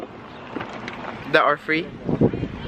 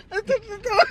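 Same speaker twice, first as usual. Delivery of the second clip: excited shout; 11 semitones higher, 4 dB quieter, faster than usual. Real, not acted. Animal reaches car, excited cry.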